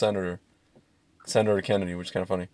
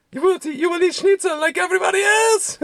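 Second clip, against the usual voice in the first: put-on German accent. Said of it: "he's like".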